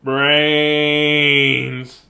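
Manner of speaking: normal talking voice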